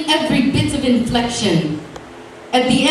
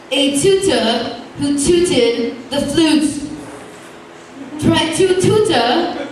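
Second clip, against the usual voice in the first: climbing higher and higher, ending in a laugh; tongue-twister recital; 6 semitones higher than usual. A narrator's reading. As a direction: race the tongue twister up to a squeal.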